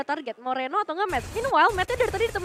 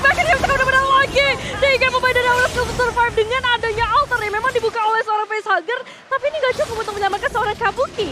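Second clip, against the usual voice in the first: higher, louder hype play-by-play; excited esports caster; 8 semitones higher, 6 dB louder than usual. Real, not acted. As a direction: call the clutch save with rising excitement. Hype caster call of a clutch.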